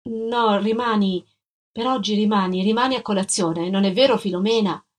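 ordinary delivery, nothing marked

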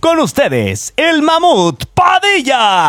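drawn-out, high ring-announcer call; announcer introduction. Real, not acted. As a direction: stretch the name into a big climax. Announcer introduces co-host by name. (presentación a grito alargado de locutor)

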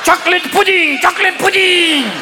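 high, chanting stadium call; demented old pope. Acted. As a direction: intone it like a blessing to a crowd.

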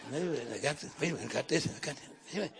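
low, soft laughing mock boast; a hint of a cocky believer, chuckling.

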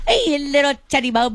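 high, quick cooing at a pet; pet talk. Shown as a demonstration. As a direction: coo it to a cat. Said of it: "I'm like".

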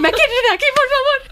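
high, squealing mock-panicked plea. Retelling her fright at the spiders in Costa Rica, high panicked plea. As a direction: replay the fright of wanting out. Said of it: "Yo dije".

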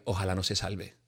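low, soft spiteful wish; a low, soft spiteful wish of damnation; muttered curse. Retold or imitated. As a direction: say it under your breath. Voicing a wish of ill.

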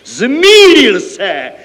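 loud, high, long-drawn cry of triumph; exultant accountant's shout; jubilant. A narrator's reading. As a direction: crow it out, stretching the words. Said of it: "jásal účetní".

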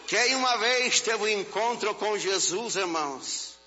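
high-pitched preaching, building to a loud cry; fervent preacher's crescendo; impassioned preaching. Real, not acted. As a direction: raise the voice and drive it to a ringing peak. Preaches at rising force.

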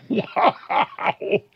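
breathless laugh into a wow; real incredulous laugh. Real, not acted. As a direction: laugh it off in disbelief. Laughing in disbelief at candidate.

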